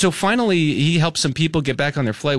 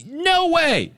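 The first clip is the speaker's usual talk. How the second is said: insistent raised voice, pitched up; retold yell; 9 semitones higher, slower than usual. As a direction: relive telling the airport worker off. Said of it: "I had to raise my voice and yell".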